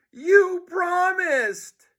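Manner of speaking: very high, very slow wail; betrayed kid pleading; horrified George. Acted.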